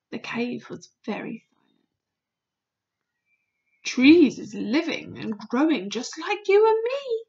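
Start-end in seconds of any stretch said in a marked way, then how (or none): 3.8-7.2 BFG. Acted. Voices the BFG in the story.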